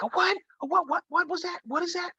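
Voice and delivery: put-on very high, squeaky panicked kid; frightened little brother. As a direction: squeak it out like a scared small boy. Scared little brother asks.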